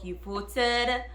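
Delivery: ordinary talk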